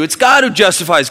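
loud, high, with GOD punched; preached emphasis. Acted. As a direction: proclaim it, lean on God. Repeat preached after verse read.

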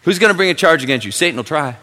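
loud, high, driving pulpit voice; full-force preaching. Acted. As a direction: warn them hard about Satan.

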